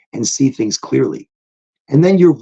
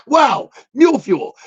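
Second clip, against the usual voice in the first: high, slow exclaim of delight; excited shout; 11 semitones higher, slower than usual. Retold or imitated. Reacting to the strain name, high delighted exclaim. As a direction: call it out thrilled. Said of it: "I'm like".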